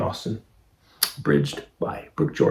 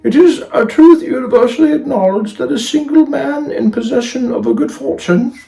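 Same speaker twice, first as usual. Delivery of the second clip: grand storybook opening; 11 dB louder than usual. A narrator's reading. Narrator opens the love story for listeners, high grand declamation. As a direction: proclaim it high and loud, then ease down. Reads the famous opening line.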